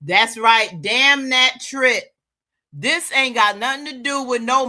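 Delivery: loud, high, drawn-out emphasis; angry shout. Acted. Man dismissing the trip in a quarrel, loud and slow. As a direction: slam each word down loudly.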